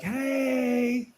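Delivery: high happy attendant hello; cheery greeting. Retold or imitated. Retelling a friendly gas station clerk, high and cheery. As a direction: greet like it's the best day. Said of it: "they're like".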